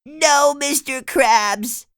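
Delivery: high, slow begging; pleading. Acted. SpongeBob pleading with Mister Krabs, slow and high. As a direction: beg to stay at work.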